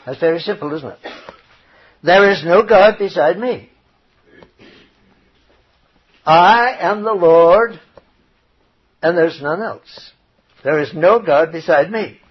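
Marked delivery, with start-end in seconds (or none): 2.0-12.2 loud, measured proclamation with long pauses; emphatic scripture reading. Acted. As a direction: declare the verse with solemn authority.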